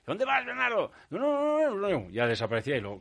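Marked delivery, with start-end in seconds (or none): 0.1-0.9 caretaker calling out. Retold or imitated. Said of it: "pasar por delante de la Bedel". 1.1-2.1 hasty denial. Retold or imitated. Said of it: "dodging the caretaker".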